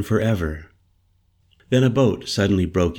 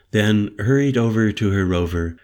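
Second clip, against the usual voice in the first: plain reading voice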